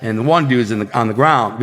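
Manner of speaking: ordinary talk, nothing marked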